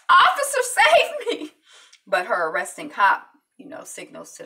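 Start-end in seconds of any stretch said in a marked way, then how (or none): 0.1-0.6 high, loud, laughing rising call; mock damsel in distress; laughing mock plea. Eva mockingly calls a passing cop. 0.6-1.5 mock plea for help.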